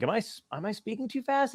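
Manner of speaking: self-questioning. Retold or imitated. Newcomer to LA checking themselves in friendly conversation, self-questioning. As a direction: imitate a hushed, unsure aside to oneself.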